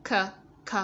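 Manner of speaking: "K" as a short "kuh"; two separate little "kuh" sounds for "K"; phonics sound. Shown as a demonstration. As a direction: sound out "k" as a letter sound, not its name. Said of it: "Ở phần phonics của chúng ta".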